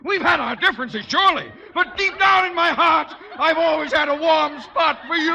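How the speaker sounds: panicked high shouting; frightened man shouting and pleading; hysterical. Acted. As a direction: babble in terror to calm the attacker. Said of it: "don't get hysterical".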